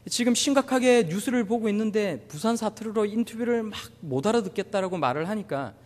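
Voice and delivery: normal talking voice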